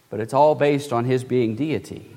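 usual speaking voice

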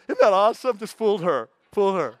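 loud, gleeful gloat with a laugh; mock gleeful prankster gloating. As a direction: crow over the prank. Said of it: "I'm like".

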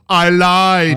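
loud, punchy deadpan Arnold line. Retold or imitated. Friend quoting Commando's famous line, loud Arnold deadpan. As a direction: bark it out like Arnold. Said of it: "And he goes".

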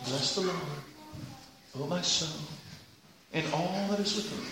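very soft, low, subdued psalm; muted recital. Shown as a demonstration. Preacher showing how the psalm should not sound, muted. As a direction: say it small and restrained. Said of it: "can you hear it like that".

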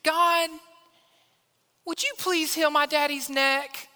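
put-on high little-boy prayer voice; child praying. Toddler prays for daddy's neck, high child voice. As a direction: imitate a toddler praying earnestly, pitched high. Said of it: "He says".

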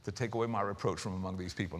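low, soft and grateful; tender, soft. Retold or imitated. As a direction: say it softly, with gratitude. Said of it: "she's saying".

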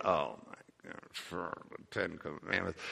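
low, soft groan of disbelief; groaning friend. Retold or imitated. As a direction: mutter it like a friend rolling their eyes. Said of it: "the look on their face going".

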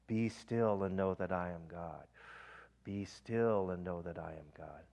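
slow, soft and low breath prayer; breath prayer demo. Shown as a demonstration. Preacher demonstrating a stillness prayer, slow and soft. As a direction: let each word settle, slow and soft. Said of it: "Or one I read yesterday".

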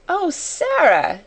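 high, drawn-out cry of joy; delighted cry. A narrator's reading. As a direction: cry out in delighted admiration, slowly falling. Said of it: "she cried out".

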